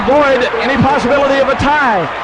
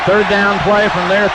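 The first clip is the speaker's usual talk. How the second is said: ordinary talk, nothing marked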